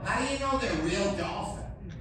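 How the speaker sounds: high-pitched dim passenger; mock yelling woman. Woman doubting the dolphins, mock yelling. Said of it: "Some stupid woman yells out".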